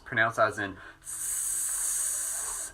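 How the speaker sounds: slow unvoiced hiss of S. Shown as a demonstration. Teacher demonstrating the S sound as a long hiss. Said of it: "the sound S".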